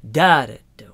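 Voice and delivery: loud first DA, two weak ones; dactylic stress. Shown as a demonstration. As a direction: punch the first da, drop the others. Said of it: "the dactylic foot is like".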